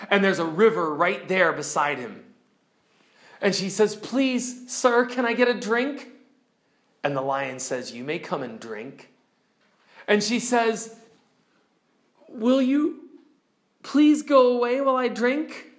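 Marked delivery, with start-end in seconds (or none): 3.9-6.1 put-on higher, small pleading girl's voice; timid girl Jill. Jill timidly asks lion. 7.9-9.1 lower, much softer, solemn lion; calm lion. Acted. Lion answers Jill. 12.3-15.7 put-on higher, small pleading girl's voice; timid girl Jill. Jill asks lion to leave.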